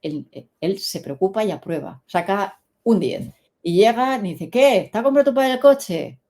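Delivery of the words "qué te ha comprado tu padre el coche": higher, louder mock-eager question. Schoolmates asking the boy about the car, acted eager questioning. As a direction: pounce on him like a curious mate.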